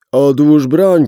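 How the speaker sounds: very loud command, stress on "broń"; loud order. Acted. Gunman shouting at the policeman to drop the gun. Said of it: "powiedziałem".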